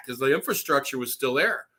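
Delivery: ordinary talk, nothing marked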